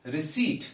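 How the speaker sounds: receipt said "risit" with no P; silent P in receipt. Shown as a demonstration. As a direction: say receipt without the P sound. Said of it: "So P is silent".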